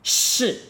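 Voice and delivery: loud, high "Shi" crashing downward; exaggerated angry tone. Shown as a demonstration. Teacher overdoing the drill, exaggerated angry tone. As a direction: spit out "Shi" hard, falling from high. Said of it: "doesn't have to be so exaggerated".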